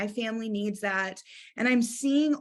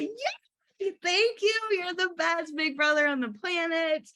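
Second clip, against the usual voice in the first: squealing high, overjoyed thanks; gushing thanks; 8 semitones higher than usual. Pretend. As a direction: thank him like he made your day. Brother agrees, she gushes thanks.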